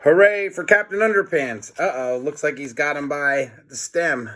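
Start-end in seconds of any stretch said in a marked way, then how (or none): 0.0-1.7 cheer. Acted. Cheering the hero.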